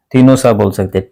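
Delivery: usual speaking voice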